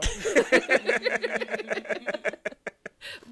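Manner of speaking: loud high burst of laughter; big laugh. Real, not acted. Laughing at shout-out.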